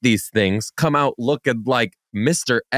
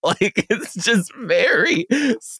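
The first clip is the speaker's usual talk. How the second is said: ordinary talk, nothing marked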